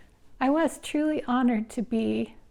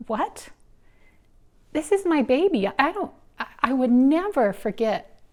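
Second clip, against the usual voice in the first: higher and louder, shocked refusal; indignant protest; 5 semitones higher, 5 dB louder than usual. Retold or imitated. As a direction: push back hard at the doctor. Mother answering doctor's advice.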